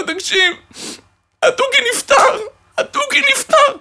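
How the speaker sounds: high, teary sob of a voice; crying voice. Retold or imitated. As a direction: wail the bad news through tears. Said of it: "עם קול של, של בכי". (בקול בוכה ומתייפח)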